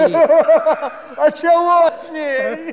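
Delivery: slow, high, loud laughing question. Acted.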